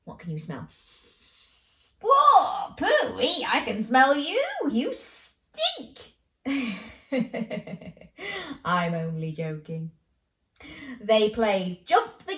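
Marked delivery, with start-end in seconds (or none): 2.0-6.0 high, loud playful yuck; mock disgust. Storyteller teases the listening child, loud and high with mock disgust. Playful teasing about smell. 6.4-8.6 light chuckle. Real, not acted. After teasing joke.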